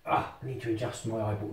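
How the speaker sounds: ordinary talk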